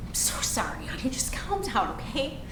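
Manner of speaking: hushed, near-whispered comfort; gently calm a child who can't breathe; soothing apology. Acted. Mother apologising to hyperventilating daughter, soothing hush.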